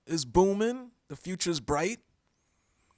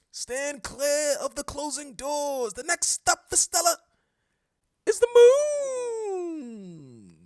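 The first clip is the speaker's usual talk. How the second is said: subway announcer voice; 8 semitones higher, 6 dB louder than usual. Pretend.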